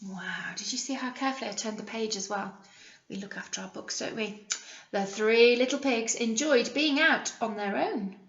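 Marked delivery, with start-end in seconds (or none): none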